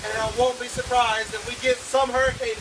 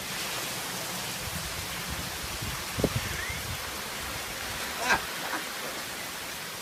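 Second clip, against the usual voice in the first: long quiet giggle; 10 dB quieter than usual. Real, not acted. Reporter breaks up laughing.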